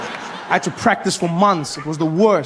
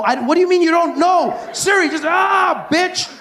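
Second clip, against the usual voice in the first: much higher, frustrated shout at the phone; exasperated yell; 12 semitones higher than usual. Retold or imitated. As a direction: lose patience with Siri. Frustrated at Siri.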